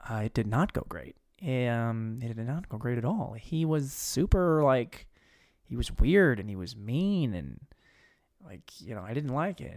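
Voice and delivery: lightly voiced reluctant girl, voice dropped; girl's reply. Girl tells the station how the date went, low hesitant reply. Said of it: "And then she goes".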